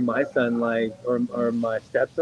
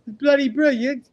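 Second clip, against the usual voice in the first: "bloody" thrown up high in a mock British rave; mock British delight; 10 semitones higher than usual. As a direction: repeat "It's bloody brilliant" much higher, as a delighted English kid. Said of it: "they're just like".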